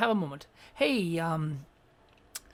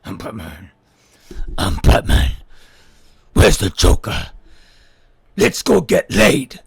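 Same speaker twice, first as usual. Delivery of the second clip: much lower growling Batman; 12 semitones lower, 9 dB louder than usual. Shown as a demonstration. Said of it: "don't do the voice".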